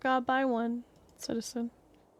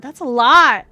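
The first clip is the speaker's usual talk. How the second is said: loud exclamation; 15 dB louder than usual. Real, not acted. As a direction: burst out much louder, astonished. Reacting to the poll numbers.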